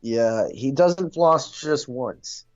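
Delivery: usual speaking voice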